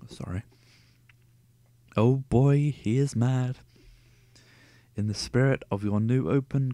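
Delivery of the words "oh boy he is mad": amused. Real, not acted. Reader reacts to the shouting.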